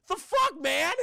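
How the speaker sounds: high, loud mock disbelief; hammed-up incredulous yell; bewildered outburst. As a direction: blurt it out, baffled and alarmed. Acting Kevin's bewildered outrage.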